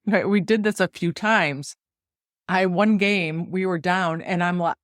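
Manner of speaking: normal talking voice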